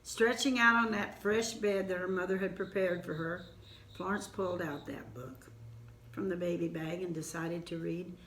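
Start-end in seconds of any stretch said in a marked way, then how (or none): none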